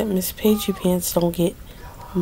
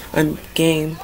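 normal talking voice

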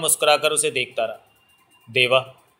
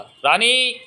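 high, drawn-out shout of a name; raised voice, pitch soaring on the name; sharp call; 10 semitones higher, much slower than usual. A narrator's reading. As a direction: call out sharply to someone at a distance. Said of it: "Sumitra का तेज स्वर उसके कानों में पड़ा".

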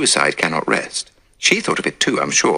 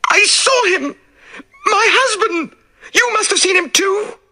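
hysterical shout. Acted. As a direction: scream it in terror. Widow screaming she saw husband.

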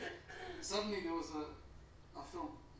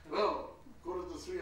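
ordinary delivery

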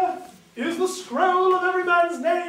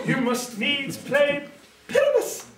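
usual speaking voice

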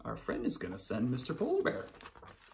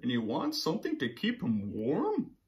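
high, slow sleepy panda; 8 semitones higher, slower than usual. Acted. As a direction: read it as a drowsy panda. Panda's voice in the story.

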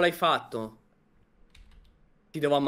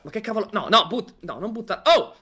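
normal talking voice

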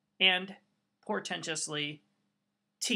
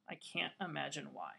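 much softer, lower dry throwaway; sarcastic aside; 5 semitones lower, 12 dB quieter than usual. Acted. Teacher to students about the empty tax job: hushed sarcasm. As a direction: mutter it as obvious irony.

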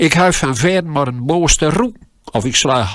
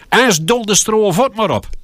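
boss snapping back; 8 semitones higher than usual. Retold or imitated. Boss snaps back at the boy.

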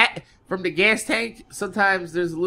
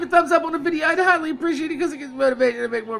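fast, higher outro plea; quick, higher-pitched like-request; rushed plea; 8 semitones higher, much faster than usual. Acted. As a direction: rattle off the ask.

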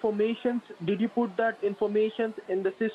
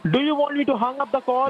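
fed-up yell; 5 semitones higher, 6 dB louder than usual. Real, not acted. Husband loses patience on call.

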